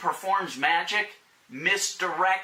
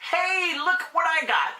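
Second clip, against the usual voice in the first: high, mocking braggart voice; mock a flashy show-off; bragging show-off; 10 semitones higher than usual. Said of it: "guys that come out and say".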